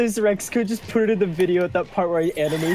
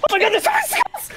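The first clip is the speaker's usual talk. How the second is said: high panicked squeal. Real, not acted. Panicking in game.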